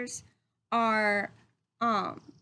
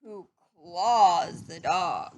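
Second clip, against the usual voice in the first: slow, louder dramatic reading; building storybook suspense; 4 dB louder, slower than usual. Acted. Teacher reading the chaotic ending to the class, slow and dramatic. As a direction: build each line like a chain of mishaps. Dramatic climax of the read-aloud.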